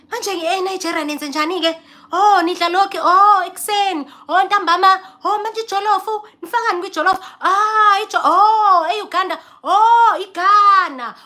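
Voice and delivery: high, bright fake-interested small talk; fake polite chatter. Guest faking interest in hosts' jollof, voice high. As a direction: feign eager interest to hosts. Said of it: "polite small talk with hosts".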